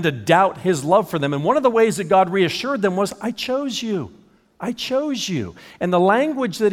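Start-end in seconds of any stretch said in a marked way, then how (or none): 3.2-5.5 God gently reassuring doubting people; reassuring insistence. Acted. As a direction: reassure them tenderly, twice.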